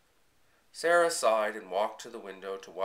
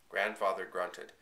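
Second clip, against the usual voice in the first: ordinary delivery, nothing marked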